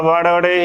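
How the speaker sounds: ਵੜੜੇ with two flaps and an "e" ending; common mispronunciation. Shown as a demonstration. Teacher quoting the congregation's slip, mispronunciation. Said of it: "ਜ਼ਿਆਦਾਤਰ ਸੰਗਤਾਂ".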